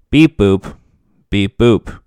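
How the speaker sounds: flat robotic beeps. Acted. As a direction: beep boop like a robot. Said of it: "Robotic [name]".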